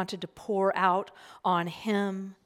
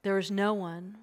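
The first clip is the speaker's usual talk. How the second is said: ordinary talk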